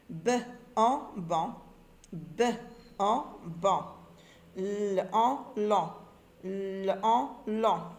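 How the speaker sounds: letter sound, pause, "en", then the blended syllable. Shown as a demonstration. Teacher modelling forming and reading syllables, sounds separated then blended. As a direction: blend ban and lan from their separate sounds, slowly. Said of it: "Forme et lit des syllabes".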